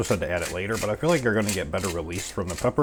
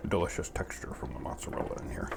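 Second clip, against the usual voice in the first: soft unvoiced whisper; 2 semitones lower, 8 dB quieter than usual. Real, not acted. Cook whispering about mozzarella's texture. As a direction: breathe the words out.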